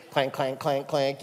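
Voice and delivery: clank clank, like dishes banged in the sink; imitated dish clanking. Martha banging dishes while others listen, mock clanking. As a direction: knock out the clanks like a dish sound effect. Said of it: "she begins to clank dishes".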